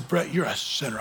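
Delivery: low, soft hint of a whispering devil; lightly voiced devil in the ear; Satan whispering. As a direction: murmur the accusation like Satan. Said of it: "When Satan whispers in my ear".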